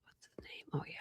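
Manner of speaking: breathy whispered question to herself; whispered to self. Real, not acted. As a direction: whisper it as you search your memory. Whispering to herself while searching.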